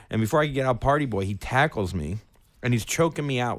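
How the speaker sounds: ordinary talk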